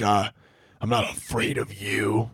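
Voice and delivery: slow, strained mock-confident growl; put-on tough voice, trembling underneath. Shown as a demonstration. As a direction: pretend to be confident while afraid. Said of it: "Where you, like, lower your voice".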